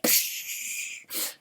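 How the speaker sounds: a breathy psh for a cymbal crash; playful cymbal psh. Retold or imitated. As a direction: make the cymbal sound with your mouth.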